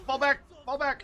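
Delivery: high, urgent panicked command; frantic retreat order. Acted. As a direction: yell it in a panic, pulling troops back. Troops retreating in game.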